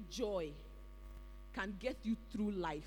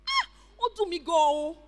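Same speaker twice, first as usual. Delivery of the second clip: high, loud cry of being caught out; stung exclamation; 7 semitones higher, 10 dB louder, slower than usual. Retold or imitated. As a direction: yelp at being called out. Stung reaction to rebuke.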